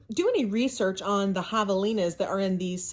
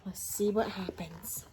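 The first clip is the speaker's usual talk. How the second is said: soft whisper of anticipation; suspense whisper; 8 dB quieter than usual. Real, not acted. Teacher teasing the class about what comes next, in a whisper.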